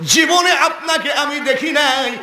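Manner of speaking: high, insistent objection; high protest. Retold or imitated. Amina tells the priest they never met, in high protest.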